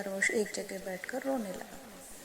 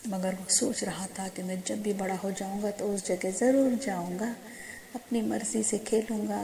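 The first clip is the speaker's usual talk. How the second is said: ordinary delivery, nothing marked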